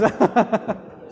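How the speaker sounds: laughing at his own joke; amused laugh. Real, not acted. Preacher after joking about his own impression, amused laugh.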